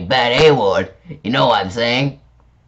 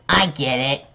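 ordinary delivery

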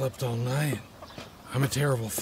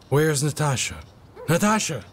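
loud call rising to a shout; yelling; 3 semitones higher, 5 dB louder than usual. Acted. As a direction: call out loudly for someone missing. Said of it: "Please don't yell".

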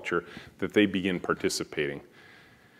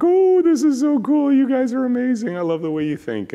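gushing customers; 9 dB louder than usual. Retold or imitated. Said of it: "They're like".

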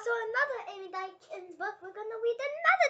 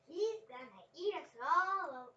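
slow warning; slower than usual. Acted. Child reads Dot's line.

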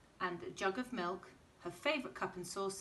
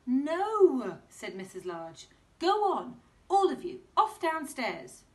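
high, loud, impatient mum; exasperated mum voice; mum shooing kids; 6 semitones higher, 11 dB louder than usual. Acted. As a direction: send the kids away. Mum shoos children.

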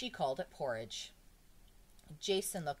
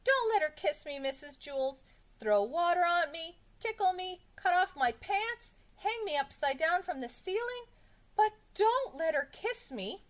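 high, loud, frantic begging; screaming; 13 semitones higher, 8 dB louder than usual. A narrator's reading. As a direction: beg in panic, keeping pitch way up. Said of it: "Jason screamed".

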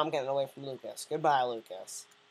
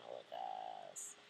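much lower and softer, a put-on growl; deep mock voice; 16 dB quieter than usual. Teasing villager Lucas in a deep put-on voice. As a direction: drop the voice low to tease. Mocking Lucas after a laugh.

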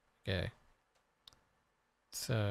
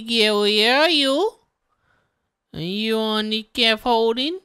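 calling a cat; 4 semitones higher, 3 dB louder than usual. Acted.

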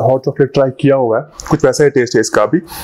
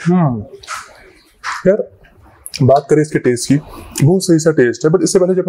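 chewing while speaking; talking between bites; mouth full; 3 semitones higher than usual. Real, not acted. Seen 2: Spoon bites just before; speaks with closed, working mouth, touches lips.

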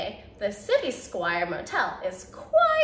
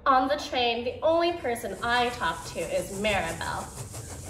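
usual speaking voice

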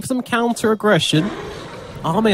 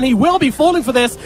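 normal talking voice

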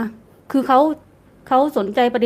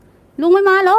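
drawn-out, high-pitched retold yell; mock shout; 9 semitones higher, 5 dB louder, slower than usual. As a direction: imitate a jeering call, high and loud. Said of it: "ตะโกนว่า".

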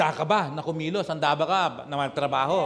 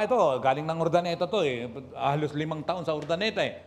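ordinary talk

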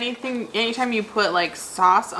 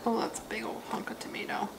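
soft whisper while chewing; 14 dB quieter than usual. Real, not acted. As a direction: whisper it close to the mic.

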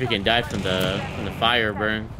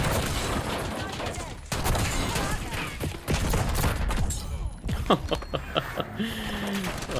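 airy high giggle, fading out; long high breathy laugh; 12 semitones higher, 7 dB quieter than usual. Real, not acted. Long laugh after humming.

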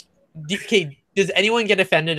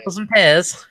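mock Cockney; 3 semitones higher, 4 dB louder than usual. Echoing Cockney rhyming slang.

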